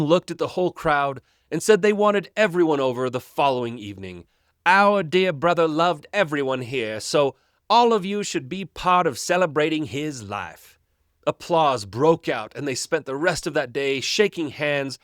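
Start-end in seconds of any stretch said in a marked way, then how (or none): 4.7-10.8 Southern swindler in a bad British accent, pious and sweet; hammed-up fake British accent from a Southern con man; syrupy fake British accent with Southern roots; southern accent faking British. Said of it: "very southern accent, but he's also trying to do a British accent poorly".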